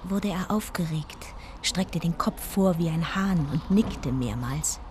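hushed, even storytelling voice; narrating a trip remembered; story narration. A narrator's reading. First-person story narration.

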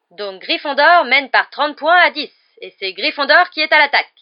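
match commentator. Acted. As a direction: announce the score to the whole stadium.

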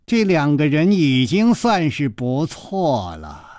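ponderous, ringing giant's voice; slow, booming voice. A narrator's reading. Giant answering his small partner, slow booming voice. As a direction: draw each word out, resonant as a great bell. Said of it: "却如洪钟大吕".

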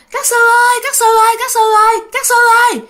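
high, loud repeated call for the monks. Retold or imitated. Grandmother screams for the monks, loud high calling. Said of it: "bà ngoại la quá trời".

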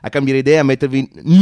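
usual speaking voice

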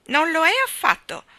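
più acuta e più forte, a corto di fiato; senza respiro. A narrator's reading. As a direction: ribatte ansimando, acuta e forte. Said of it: "senza respiro". (senza fiato, voce alta e forte)

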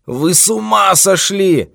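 high, slow, loud outraged cry; roaring. A narrator's reading. Old man offended by talk of a clinic, roaring. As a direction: roar it out in outrage. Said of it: "заревел старик".

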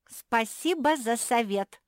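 loud, high, slow mock thanks; sarcastic. A narrator's reading. Sister rejecting advice, sarcastic. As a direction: draw out the thanks with a sting. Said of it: "язвительно засмеялась Жози".

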